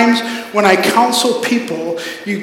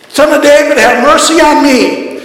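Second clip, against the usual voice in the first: louder, higher cry for mercy; desperate shout for help; yelled plea; 5 semitones higher, 5 dB louder than usual. Retold or imitated. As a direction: call out over the crowd. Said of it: "he just starts yelling".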